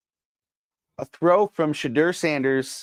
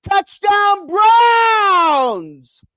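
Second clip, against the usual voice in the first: huge drawn-out victory scream, much higher and louder; yelled; 8 dB louder, much slower than usual. Retold or imitated. Fan at home screaming at the TV, drawn-out touchdown yell. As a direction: bellow it like the winning score just landed. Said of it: "And I yelled".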